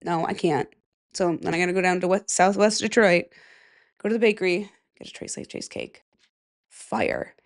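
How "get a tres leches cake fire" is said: hushed, breathy aside; whisper. Real, not acted. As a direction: whisper it like a secret. Whispered food praise.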